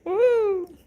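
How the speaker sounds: excited whoop. Real, not acted. Reacting to chat joke.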